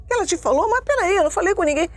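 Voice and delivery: high-pitched, flustered retold questions; agitated, incredulous protest; imitating nervous father. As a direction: mimic a dad alarmed at being found out. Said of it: "Pai, não fica nervoso".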